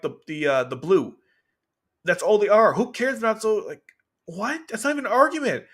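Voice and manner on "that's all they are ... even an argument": high-pitched exasperated rebuttal; indignant protest. Real, not acted. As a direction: argue back in disbelief. Indignant at the article's argument.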